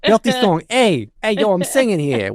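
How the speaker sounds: hammed-up Bronx tough talk; mock Bronx street accent. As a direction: play up the Bronx vowels. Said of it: "your Bronx accent came out".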